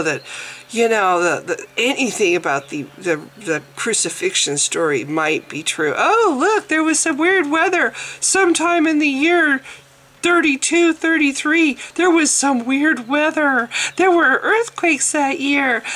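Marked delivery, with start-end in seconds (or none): 6.0-15.8 mock excitement. Mocking Christians searching weather.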